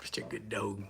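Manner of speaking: tender low dog talk; cooing to dog. Real, not acted.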